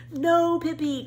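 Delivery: high, loud plea of alarm; alarmed cry. A narrator's reading. Children crying out as Pippi wants to fly, alarmed. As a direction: stop her in a fright. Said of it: "cried both Tommy and Annika".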